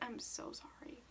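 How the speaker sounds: breathy, barely voiced sorry; sheepish whisper. Apologizing to the classmate in a sheepish whisper. Apologizing after embarrassing mistake.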